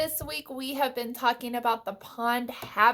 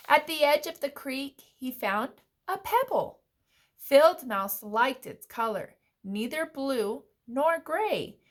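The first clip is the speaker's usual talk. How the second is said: put-on animated read-aloud for young children; story narration; 3 semitones higher, 3 dB louder than usual. A narrator's reading. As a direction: read a picture book with lilting, clear emphasis.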